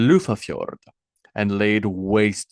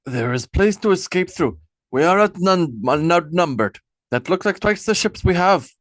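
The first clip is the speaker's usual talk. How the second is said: Einar pitched higher and anxious; a nervous younger lord warning his king; alarmed lord Einar urging retreat; worried Einar; 9 semitones higher, 4 dB louder than usual. A narrator's reading. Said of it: "confirmed Einar".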